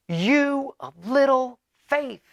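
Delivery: slow, high, drawn-out rebuke; loud Jesus. Retold or imitated. Jesus teases his frightened disciples, mock loud slow rebuke. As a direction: stretch it out like calling over the storm. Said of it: "he says very loudly".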